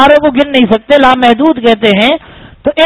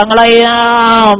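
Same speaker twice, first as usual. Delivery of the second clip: drawn-out word, like stretching awake; lazy stretch; slower than usual. Acted. Preacher mimics a sleeping lion stretching, drawn out lazily. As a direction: stretch the word out long and slow.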